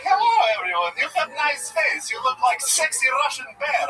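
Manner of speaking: high, loud mock Russian accent. Comic tells the crowd his inner voice has a Russian accent. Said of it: "my inner voice in here is just like".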